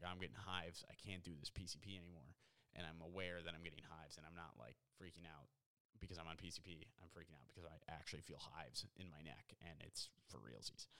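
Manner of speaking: low, soft, understated pretend worry; mock earnest aside. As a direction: drop low and quiet, confiding in pretend concern. Imagined PCP user's worried aside.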